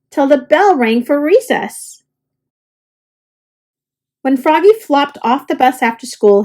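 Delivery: plain reading voice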